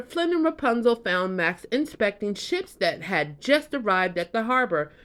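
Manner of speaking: usual speaking voice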